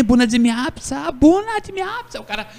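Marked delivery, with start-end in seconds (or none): 0.0-1.1 excited greeting. Retold or imitated. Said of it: "Oh, Romain". 1.2-2.2 much higher, bună rising up in delight; ecstatic greeting. Retold or imitated. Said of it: "Oh, Romain".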